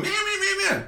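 high squeaky meow calls; mock crow cries. Mocking the crows in a high squeak. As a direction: squeak it like silly birds.

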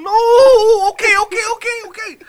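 very loud, high wail into rapid okays; mock panicked pleading. Kid giving in before the ass beating, mock panic. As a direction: cave in like a terrified kid, hammed-up.

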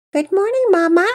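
loud, very high little-kid imitation; high-pitched. A narrator's reading. Pearson pretending to be Patience for Mama Peters, high-pitched. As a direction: pipe it up high, pretending to be a younger child. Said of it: "said in a high-pitched voice".

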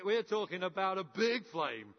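ordinary delivery

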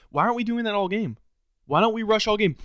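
loud, fed-up rhetorical questions; angry rant. Real, not acted. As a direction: demand answers from the coaches. Ranting at the coaches.

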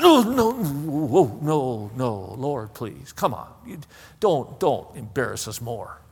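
drawn-out protest sinking to a low, soft plea; pleading Martha. Acted. Martha begs the Lord not to open the grave, hushed and pleading. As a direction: beg in an embarrassed undertone after long noes. Acts out Martha begging Jesus to stop.

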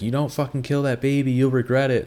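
put-on threatening boyfriend. Boyfriend threatening girlfriend over baby.

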